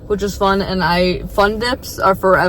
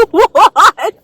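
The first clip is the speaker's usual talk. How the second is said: laughing disbelief; 13 semitones higher, 6 dB louder than usual. Real, not acted. Laughing disbelief at retold moment.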